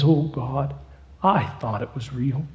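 despairing. Acted. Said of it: "Ed falls in despair".

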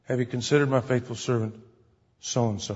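a hint of a low divine voice; voice of God. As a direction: speak as God boasting of a servant. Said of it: "God can say".

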